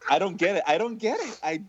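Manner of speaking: ordinary delivery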